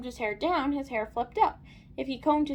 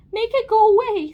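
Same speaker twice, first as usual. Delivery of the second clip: high, loud lady's yell; distressed demand; 8 semitones higher, 11 dB louder than usual. A narrator's reading. Lady begs Aaron to remove the hair, yelling. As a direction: cry out for it to be removed.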